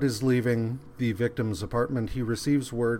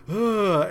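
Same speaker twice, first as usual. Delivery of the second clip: mock villager grunt; 9 semitones higher, 5 dB louder than usual. Angry villagers growl at Shrek.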